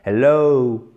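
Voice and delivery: hello with a long -low gliding into ou; slow teacher's demo of hel-LOOW; drawn-out diphthong ending. Shown as a demonstration. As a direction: drag out the end of hello as a double sound. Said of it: "ça descend, et hop, ça remonte légèrement".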